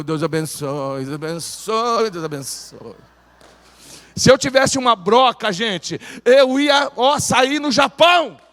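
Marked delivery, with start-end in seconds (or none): 0.0-3.0 quiet, slow, embarrassed blessing; soft, drawn-out, ashamed thanks; sheepish mumble. Retold or imitated. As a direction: mumble it shyly while taking the money. Shy mumbled blessing while taking offering.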